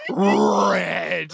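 rolled, stretched-out emphasis; comically elongated delivery; dramatic drawn-out word. A narrator's reading. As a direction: draw the word way out for comic effect. Host's blush drawn out for effect.

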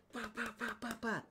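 high, softened "pa" knocks in a row; rapid mouth-made banging; imitated banging on metal. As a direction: mimic fists pounding a metal door. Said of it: "sabés cómo suena que le peguen fuerte a la chapa".

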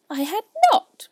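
high, loud indignant mouse; furious little squeak; sharp, very angry. A narrator's reading. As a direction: cry out in outraged denial. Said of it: "cried the mouse sharply and very angrily".

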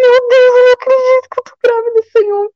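high, re-enacted cry of astonishment; overwhelmed exclamation, pitch way up; shocked disbelief. Reencena descoberta da gravidez em choque. (espanto emocionado, voz bem aguda)

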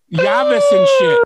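high, slow falsetto wail on llaves; mock falsetto call. Mocking mariachi singers with a high slow falsetto. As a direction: sing it up high like a mariachi grito. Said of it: "fools be singing around with fucking high-ass falsettos".

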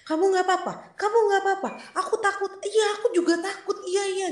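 high, frightened little voice with nervous laughs; fretful high whisper-talk to herself; scared self-talk. Retold or imitated. Talking to her own body, scared.

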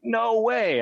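high, thrilled disbelief; retold excitement. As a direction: retell a delighted, high-pitched reaction. Retold reaction to acquisition news.

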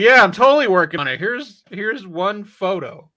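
mock director bluffing. Director gaslighting the studios for a paycheck, mock assurance. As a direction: play a director overselling his progress. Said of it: "He's like".

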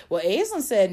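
a touch of mock whining; mock excuse. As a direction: play the kid blaming Aislinn. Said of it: "talk about".

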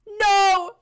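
loud, high, dramatic "no"; hammed-up death wail; anguished cry. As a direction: cry out as if being eaten. Garlic bread being bitten.

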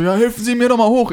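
very high-pitched mock yell; imitated insistent yelling. Homeless man insists passer-by lift him, imitated yelling. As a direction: insist loudly as the stranded old man. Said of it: "Wenn Sie mich jetzt noch einmal anbrüllen".

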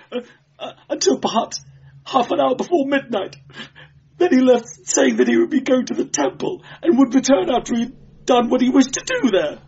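high, halting tearful whine; whimpering clerk. A narrator's reading. Beaten clerk telling when his visitor left, whimpering. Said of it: "whimpering like a beaten dog".

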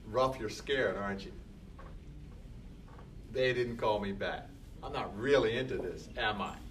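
low, soft voice questioning himself; quiet self-talk. Pretend. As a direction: gently call yourself out, softly. Look at myself and say.

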